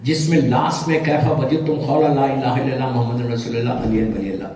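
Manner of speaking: prayer recitation. Acted. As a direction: intone it like a prayer at a grave. (دعا کی طرح پڑھا گیا سلام)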